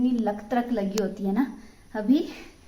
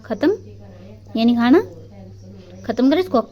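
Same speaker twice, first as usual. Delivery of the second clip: coaxing to finish; 3 semitones higher, 6 dB louder than usual. Real, not acted. Coaxing someone to finish food.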